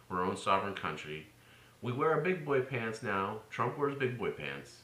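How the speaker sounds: usual speaking voice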